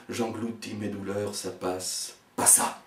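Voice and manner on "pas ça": loud panicked barks. Acted. Trapped, barking loud.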